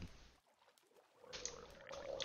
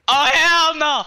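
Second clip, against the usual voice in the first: very high, very loud shriek; panicked scream; 17 dB louder, slower than usual. Real, not acted. Gamer spotting a creepy mob, panicked scream. Spooked by mob looking back.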